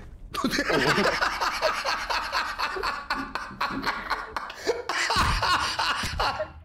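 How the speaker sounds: high breathless wheezing laughter. Real, not acted. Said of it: "non riesco a respirare".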